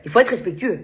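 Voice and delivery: ordinary talk, nothing marked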